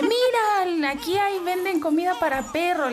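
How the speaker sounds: aviso agudo y fuerte de mamá; mamá entusiasmada imitada. Retold or imitated. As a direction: imita a una mamá que anuncia un hallazgo, con voz muy aguda. Mamá avisa entusiasmada al papá. (llamado entusiasta, cantarín y agudo)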